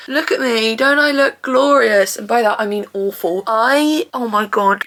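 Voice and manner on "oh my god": normal talking voice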